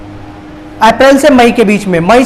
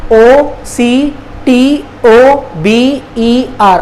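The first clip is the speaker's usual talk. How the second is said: slow, letter by letter; spelling out; much slower than usual. Shown as a demonstration. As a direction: spell it out slowly with a gap after each letter. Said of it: "अक्टूबर का spelling क्या हो गया". (एक-एक अक्षर धीरे-धीरे)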